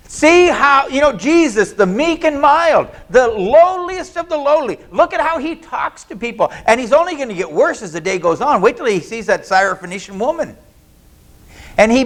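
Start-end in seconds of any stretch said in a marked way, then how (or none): 0.1-6.5 ironic. Acted. Ironic meek and mild.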